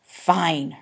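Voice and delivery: plain reading voice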